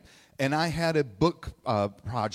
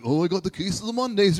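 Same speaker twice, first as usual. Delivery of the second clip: mock Garfield; 6 semitones higher, 5 dB louder, faster than usual. Mocking Garfield's jokes.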